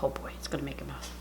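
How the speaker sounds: low breathy hush; hushed whisper at the food. Real, not acted. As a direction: whisper so you don't disturb anyone.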